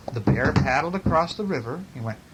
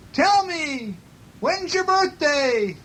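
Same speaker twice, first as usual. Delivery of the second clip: very high, drawn-out echo voice; echoing moon; 14 semitones higher, much slower than usual. A narrator's reading. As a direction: repeat it as a high mountain echo. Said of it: "It's his voice echoing".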